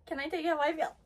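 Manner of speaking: usual speaking voice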